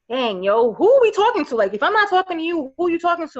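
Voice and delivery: emphatic realization. Retold or imitated. Realizing her girlfriends have nobody to talk to, emphatic. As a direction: press the point, voice up high. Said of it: "it was like".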